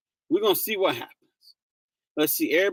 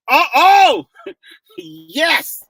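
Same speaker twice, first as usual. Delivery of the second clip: rising, much louder cries of delight; excited shout; 5 semitones higher, 10 dB louder, much slower than usual. Real, not acted. Host cheers as the guest joins the stream, loud delight. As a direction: burst out as the guest finally appears.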